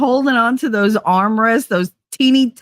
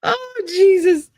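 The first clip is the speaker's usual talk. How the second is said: helpless high-pitched laughter breaking through the words; can't stop laughing, barely gets words out; crying laughing; 8 semitones higher than usual. Real, not acted. Streamer to chat, cracking up at a joke, crying with laughter. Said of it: "I'm crying".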